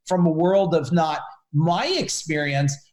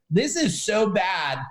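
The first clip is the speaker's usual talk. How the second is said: ordinary talk, nothing marked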